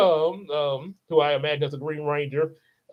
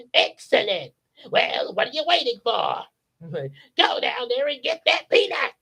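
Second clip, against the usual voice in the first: a higher, bossy villain queen; Empress Rita; 6 semitones higher than usual. Retold or imitated. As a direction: order him about like an impatient evil empress.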